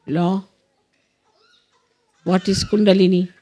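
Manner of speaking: deliberate prayer leader with long pauses; measured meditation instructions with space to follow; guided meditation. Real, not acted. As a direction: guide them through it, phrase by phrase. Leads affirmations in guided meditation.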